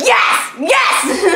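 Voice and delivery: high shouted yes; triumphant answer to Anna; excited yes. Retold or imitated. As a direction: cheer it out twice. Confirming to Anna.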